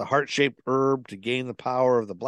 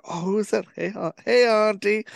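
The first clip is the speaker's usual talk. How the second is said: acted quote; 11 semitones higher, 4 dB louder than usual. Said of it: "Because she was like".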